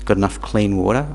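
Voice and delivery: usual speaking voice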